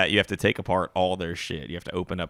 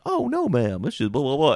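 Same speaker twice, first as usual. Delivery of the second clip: customer service voice; 6 semitones higher, 4 dB louder than usual. Retold or imitated. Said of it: "just be like".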